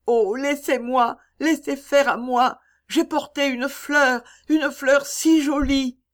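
put-on high, pleading child's voice, slowing on the flower; a little girl begging to stay.

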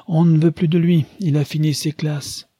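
high, lively retort with a touch of mirth; inclined to gaiety. A narrator's reading. An old lady answering her host after a shared laugh, amused. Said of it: "avec une certaine disposition à la gaieté".

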